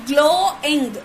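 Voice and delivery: "glowing" slowly split into glow, ing; two drawn-out beats on "glowing"; syllable by syllable. Shown as a demonstration. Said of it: "glowing is two syllable".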